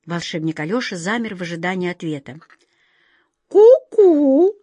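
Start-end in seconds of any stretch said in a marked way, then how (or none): 3.5-4.6 high, loud, drawn-out cuckoo call; important cuckoo. Acted. Cuckoo answering the wizard's question, with importance. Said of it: "с важностью кивнула кукушка".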